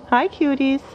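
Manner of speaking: high cooing hello to pets; sweet sing-song pet greeting. Real, not acted.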